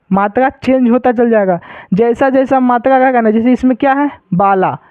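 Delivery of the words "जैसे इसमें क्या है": ordinary delivery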